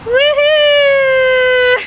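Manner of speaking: high loud whoop; cheering. Real, not acted. Cheering a saved bee.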